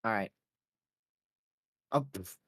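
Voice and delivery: ordinary talk, nothing marked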